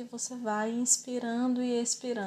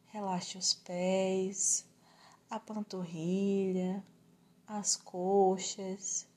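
guided relaxation; slower than usual. Acted. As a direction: slowly talk the body into rest.

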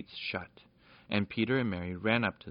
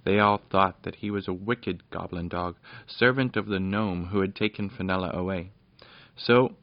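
ordinary delivery

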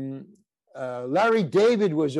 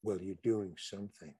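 softer, lower, confiding; hushed and close; 7 semitones lower, 13 dB quieter than usual. Retold or imitated. Director leaning closer to the actor, murmuring softer and lower. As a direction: lean in and say it for one ear only. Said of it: "leaned in a little closer".

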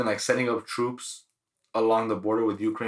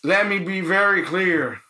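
high, slow mock presidential voice; Joe Biden; 9 semitones higher, 5 dB louder, slower than usual. Host mocks the president warning Putin, slow and high. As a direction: ham up an old politician's stern warning. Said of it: "Joe Biden talked to Putin".

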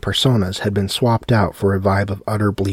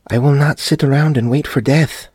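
higher, louder panicked refusal; defiant wife; 6 semitones higher, 4 dB louder than usual. A narrator's reading. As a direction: refuse to wait for death. Olga voiced defiant.

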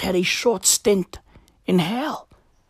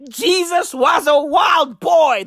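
hyped shout; 6 semitones higher, slower than usual. Acted. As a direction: roar it to the room like a hype man. Catchphrase bellowed again.

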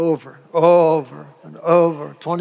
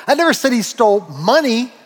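"money" set apart and punched at the end; stress on MONEY; 4 semitones higher than usual. Shown as a demonstration. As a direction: land hard on the last word, "money". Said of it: "What did he steal?".

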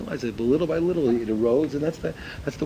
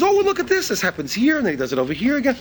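excited discovery; 10 semitones higher, 4 dB louder than usual. Retold or imitated. Visitor excitedly pointing out details.

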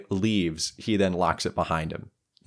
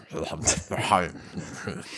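wordless mock farmer mumbles; gruff farmer grumbling; 2 semitones lower, 5 dB quieter than usual. Game master imitating the farmers' general conversation, gruff grumbling. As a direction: imitate a huddle of grunting farmers. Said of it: "bunch of gruff farmers".

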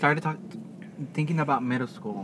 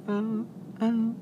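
mock whiny; 3 semitones higher, slower than usual.

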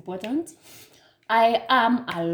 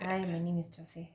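much softer than usual; quiet introduction; 10 dB quieter than usual. Real, not acted. Introducing herself to podcast listeners, very quietly. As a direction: say your name barely audibly. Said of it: "Why are you so silent".

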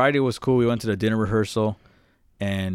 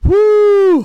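high, loud whoop; mock whoop; 14 dB louder than usual. Girlfriend whooping at the story, high and loud. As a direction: let out a loud shriek of laughter.

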